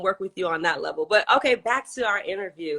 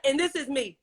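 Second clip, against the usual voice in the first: high mimicry of the father; mock-complain in a raised pitch; retold TikTok complaint; 8 semitones higher than usual. Host mimicking guest's complaint about his son, high-pitched. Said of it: "You was like".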